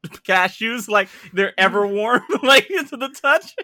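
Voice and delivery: rising laughter through the words; laughing. Real, not acted.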